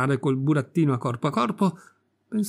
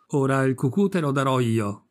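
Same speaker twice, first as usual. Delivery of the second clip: ordinary delivery, nothing marked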